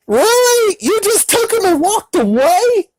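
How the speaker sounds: very high, incredulous questioning; hammed-up falsetto incredulity; mock disbelief. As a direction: squeak it out in exaggerated disbelief. Acted disbelief at her walking off.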